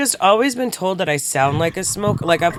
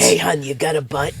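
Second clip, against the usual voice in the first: put-on smoker bumming a cigarette; mock raspy smoker voice; 3 semitones lower than usual. Host mocks how she thinks she sounds, put-on smoker voice. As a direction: play a husky pack-a-day smoker. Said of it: "that's literally how I feel like I sound".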